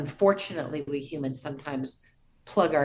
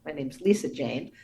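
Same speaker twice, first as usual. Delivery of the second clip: ordinary delivery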